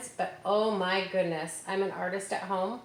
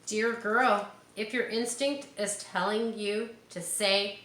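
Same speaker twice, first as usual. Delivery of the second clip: bright, slow picture-book read; reading to children; 2 semitones higher, 3 dB louder, slower than usual. Acted. Teacher reading the book to her class, bright and slow.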